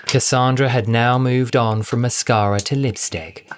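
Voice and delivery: ordinary delivery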